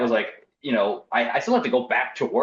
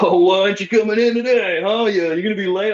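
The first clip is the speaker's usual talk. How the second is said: much higher, louder teasing bro voice; mocking bro coworkers; 9 semitones higher, 5 dB louder than usual. Game store bros teasing absent coworker, mocking. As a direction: razz him like a ball-busting buddy.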